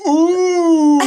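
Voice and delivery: high, loud mock crowd gasp; hammed-up crowd ooh. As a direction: coo like an impressed crowd. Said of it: "everyone's like".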